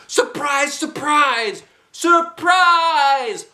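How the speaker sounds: a friend yelling with glee; excited holler. Acted. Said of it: "hollered my bestest friend".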